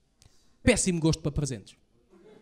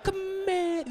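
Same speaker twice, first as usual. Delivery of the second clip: much higher mock disgust; high disgusted aside; 13 semitones higher, slower than usual. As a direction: squeal it in disgust. Reacting to the empty wake.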